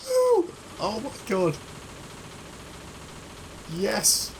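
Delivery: screaming with delight after pulling off the heist; ecstatic cheering. Real, not acted. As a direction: celebrate like you just won. Succeeds at the stealth heist.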